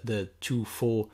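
ordinary talk, nothing marked

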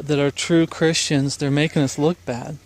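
ordinary delivery

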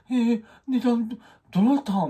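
high, loud, bewildered stammer; high-pitched puzzled stammer; cat's startled who-are-you. Retold or imitated. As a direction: act the stunned cat. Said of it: "みたいな".

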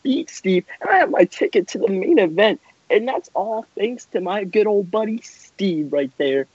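ordinary talk

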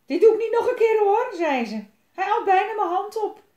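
high, loud, shaken complaint; girl complaining in a fright, high and loud; frightened shrill protest. Acted. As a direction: still shrieking after nearly being bitten. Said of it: "Elise gilde".

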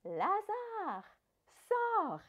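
appel crié, bien plus haut et plus fort; cri fort. Acted. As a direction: crie le nom pour réveiller le mort. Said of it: "elle cria d'une voix forte". (Jésus appelant Lazare hors du tombeau, d'un grand cri)

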